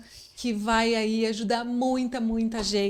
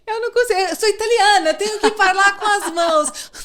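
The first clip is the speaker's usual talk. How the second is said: laughing; 7 semitones higher, 4 dB louder than usual. Real, not acted. Laughing at her own gesturing.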